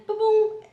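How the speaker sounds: sharp, high, loud boom boom; sound effect boom. Real, not acted. Phone lighting up mid-conversation, loud booming effect. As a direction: pop out the boom like a sudden notification.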